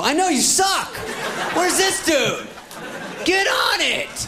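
high, impatient grandma hollering; demanding grandma. Acted. Grandma ordering her grandson to find the boy, high demanding voice. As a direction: badger him as a hammed-up pushy grandma.

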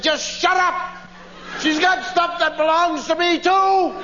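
high, exasperated snap; mock parental tirade; fed-up parent. Parent snapping at crying child, acted.

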